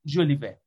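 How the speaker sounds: ژولیوه ending in "-veh"; corrected pronunciation. Real, not acted. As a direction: say ژولیوه as "Zholiveh", with a V.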